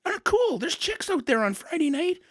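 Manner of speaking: put-on whiny teen complaint. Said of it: "I'm like".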